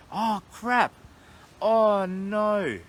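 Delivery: sudden high, loud dismay; real alarm at a forgotten promise; startled. Real, not acted. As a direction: caught out, oh no. Realizes forgotten pirate dare.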